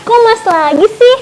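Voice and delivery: disbelieving outburst at the same agent; surprised. Real, not acted. Same agent answers again.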